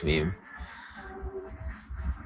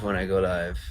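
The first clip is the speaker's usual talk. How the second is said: ordinary talk, nothing marked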